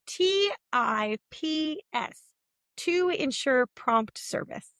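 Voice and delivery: reading the napkin slowly, letter by letter; slow, deliberate spelling out; spelled out slowly. Retold or imitated. Reading the napkin note to the listener, slow and deliberate. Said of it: "and it said".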